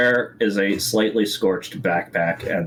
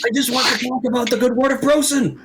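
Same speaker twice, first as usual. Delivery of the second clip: ghost voice; 13 semitones higher than usual. Acted. Ghost NPC preaching.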